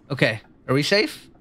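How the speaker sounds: ordinary delivery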